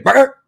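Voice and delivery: high, loud imitated single bark; dog bark imitation. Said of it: "your sound effects are spot on".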